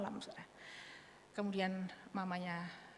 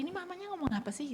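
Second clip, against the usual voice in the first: put-on puzzled child; 9 semitones higher, faster than usual.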